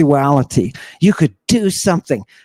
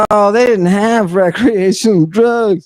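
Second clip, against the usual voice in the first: raised, sneering mimicry of the doubters; mock the people who say this, a bit higher; scoffing imitation of naysayers; mocking skeptics; 5 semitones higher than usual. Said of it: "all those people who are like".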